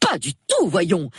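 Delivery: high-pitched indignant exclamation. A narrator's reading. Hostess defends her old friend, indignant cry. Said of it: "s'écria madame Bantry avec indignation".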